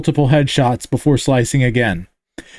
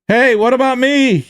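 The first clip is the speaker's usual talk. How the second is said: high call from afar; yelled; 9 semitones higher than usual. Acted. Stranded cameraman calls to the mechs, yelling. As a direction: yell to be noticed. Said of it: "yelled the cameraman".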